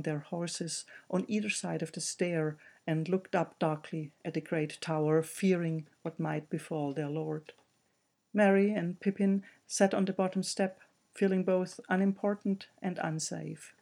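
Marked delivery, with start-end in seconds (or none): none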